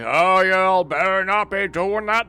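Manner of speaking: put-on loud, slow, high Southern preacher accent. Streamer mocks the stern Reverend in a Southern accent. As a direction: boom it like a Southern preacher scolding. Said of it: "I gave him a southern accent".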